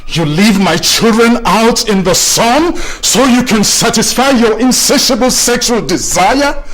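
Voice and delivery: loud, high, outraged accusing shout; furious accusation. Acted. Spouse catching partner with a friend and yelling at her, furious shout.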